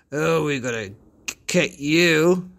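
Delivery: mock menace at the kids; bully threat.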